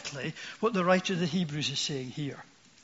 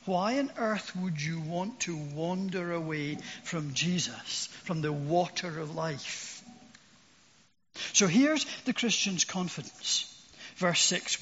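ordinary delivery

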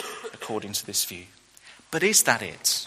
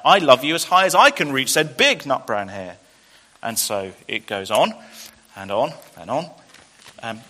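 storybook voice with acted hare lines; gentle picture-book reading with voiced hares; reading to children; 3 semitones lower, 5 dB louder than usual. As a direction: read it like a bedtime story to kids. Reads children's picture book aloud.

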